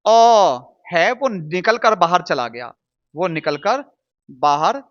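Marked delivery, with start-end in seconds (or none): 0.1-0.7 अ stretched long and loud; isolated vowel. Shown as a demonstration. Teacher isolating the vowel inside the letter, held sound. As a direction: hold अ as "uhh", then let it fall. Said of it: "इसके अंदर का जो".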